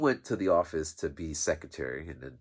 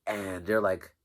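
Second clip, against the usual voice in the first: ordinary talk, nothing marked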